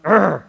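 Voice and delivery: loud, short frustrated groan; hammed-up wordless growl of frustration; exasperated growl. His exasperated reaction in the retelling.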